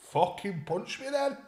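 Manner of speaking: high-pitched mock challenge; acted-out dare; retold goading.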